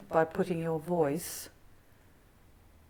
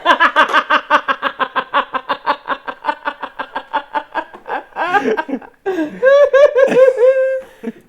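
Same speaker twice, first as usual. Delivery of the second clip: long loud laugh; 11 semitones higher, 15 dB louder than usual. Real, not acted. Bursts out laughing at the recording.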